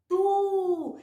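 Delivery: tu held long; blended syllable demo. Shown as a demonstration. Teacher naming the new syllable, sounded out. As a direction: sustain the u in tu. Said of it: "A sílaba é".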